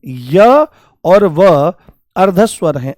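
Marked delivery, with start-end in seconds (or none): 0.1-1.7 slow teaching stress on य और व; very slow drawn-out. Shown as a demonstration. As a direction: stretch य और व out very slowly. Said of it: "आपको याद रखना है".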